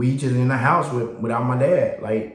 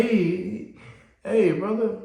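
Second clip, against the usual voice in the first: higher, overly friendly greeting; friendly stranger imitation; 8 semitones higher, slower than usual. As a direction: sell yourself to the new kid.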